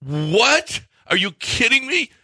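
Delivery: retold exasperated high-pitched disbelief; incredulous outburst. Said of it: "And Carlos is like".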